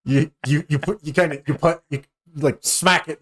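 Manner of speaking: ordinary delivery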